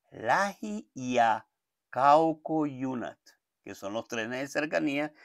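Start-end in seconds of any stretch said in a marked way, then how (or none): 0.1-3.2 "lahi" repeated slowly, "acaucoyunat" drawn out; slow Finnish drill. Shown as a demonstration. Teacher asks the student to repeat, slow and clear. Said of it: "inténtalo ahí conmigo".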